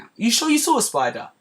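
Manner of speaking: high, doubting mock husband voice; mild gaslighting. Husband questioning his wife's spider sighting, mock doubtful. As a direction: make her doubt what she saw. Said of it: "he's doing the mild gaslighting now".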